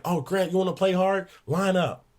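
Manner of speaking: high sarcastic mock challenge; mocking coach. Coach Barnes daring Grant to line up, high sarcastic mock. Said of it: "He's like".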